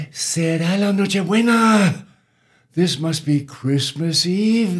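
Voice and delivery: loud, high villagers' cry of realisation; excited exclamation. Acted. Villagers realise it is Christmas Eve.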